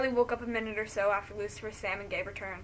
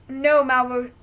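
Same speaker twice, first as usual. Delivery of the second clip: loud, higher panicked cry; shout; 4 semitones higher, 14 dB louder, much slower than usual. Acted. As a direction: yell no, rising to a scream. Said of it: "Malvo shouts".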